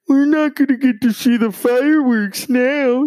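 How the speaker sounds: mock the petty complainers; mocking people annoyed about fireworks; whiny complainer.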